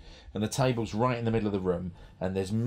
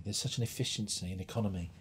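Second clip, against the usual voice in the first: ordinary talk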